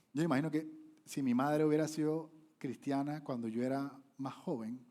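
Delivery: normal talking voice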